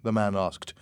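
ordinary delivery

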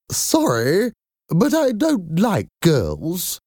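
strange, high-pitched caller; high voice with a cold. A narrator's reading. Strange caller rejecting the job, high voice with a cold. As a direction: turn someone down in a high head-cold voice. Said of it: "had a high voice and talked as if she had a bad cold".

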